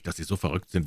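plain reading voice

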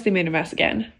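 usual speaking voice

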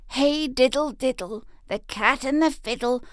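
a hint of a jealous tomcat; envious cat. A narrator's reading. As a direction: sulk it as a left-out cat. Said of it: "said Simpkin".

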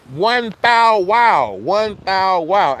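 drawn-out catchphrase. Real, not acted. Streamer to chat, catchphrase stretched very slow. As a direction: stretch every syllable very slowly.

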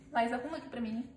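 ordinary talk

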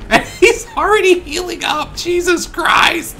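high, drawn-out squealing complaint; exasperated disbelief. Real, not acted. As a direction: wail slowly in outraged disbelief. Enemy is already healing.

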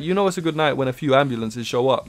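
ordinary talk, nothing marked

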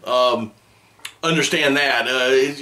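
ordinary delivery

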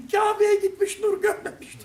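high, mocking mother's voice; mother's saying. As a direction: quote mother's proverb up high. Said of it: "benim annemin dediği gibi". (annesinin sözünü tiz sesle aktaran)

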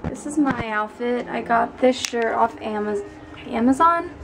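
usual speaking voice

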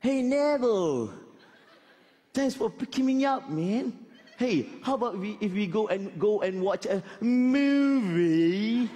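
high, drawn-out mock British; British slang accent. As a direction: imitate the London-returned friend, stretching the last word. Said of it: "dia ada British punya slang".

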